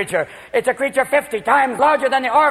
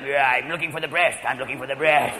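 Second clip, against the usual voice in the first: much lower, softer creature mumble; newborn creature voice; 10 semitones lower, 4 dB quieter than usual. Retold or imitated. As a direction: growl like a tiny old man. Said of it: "little tiny creature like".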